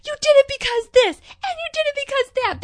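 high, loud accusing shout; mock accusing yell. Acting out the twins confronting their mother, loud mock yell. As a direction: fling the accusation hard.

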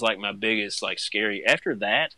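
ordinary talk, nothing marked

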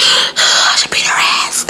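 breathless, voiceless near-sob, slowly squeezed out; mock tearful gasped whisper; almost crying. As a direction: re-enact choking back angry tears. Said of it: "I almost started to cry".

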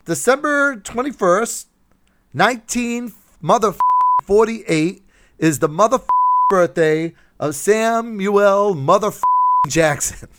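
lightly channel Samuel L. Jackson swearing; Samuel L. Jackson imitation. Host announcing the celebrity birthday, hammed up. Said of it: "host's birthday bit".

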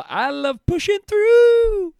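high, slow falsetto imitation of the singer; mock falsetto. Mocking the singer's cringy falsetto, high and drawn out. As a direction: float it up high and slow like a crooner. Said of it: "he goes so falsetto".

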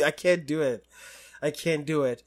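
ordinary talk, nothing marked

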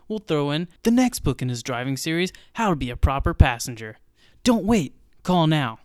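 loud, pushy TV pitchman; infomercial. Pretend. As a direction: sell it hard like a late-night ad. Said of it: "a word for our sponsors".

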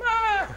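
very high mock scream; death scream. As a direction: shriek like a murder victim.

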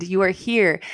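ordinary delivery

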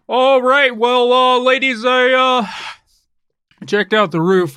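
loud, high blustery landlord; big hammy announcer-style entrance; booming character voice. Acted. Steven character enters booming.

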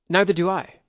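put-on a boy loudly matching his companion's resolve; eager echo. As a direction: jump in eagerly. Akio eagerly echoing.

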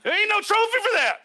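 high, exclaimed pulpit punchline; emphatic preaching. Real, not acted. Mock award, then preached punchline.

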